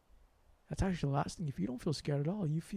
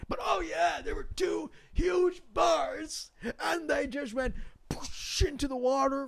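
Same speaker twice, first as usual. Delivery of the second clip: thrilled outburst much higher in pitch; excited shout; 9 semitones higher, slower than usual. Real, not acted. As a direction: blurt it out, wide-eyed. Telling the dramatic bird arrival.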